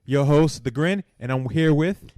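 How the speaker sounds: loud, upbeat show opener; podcast host intro. Acted. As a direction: open the show like a radio host. Podcast opening, hosting the show.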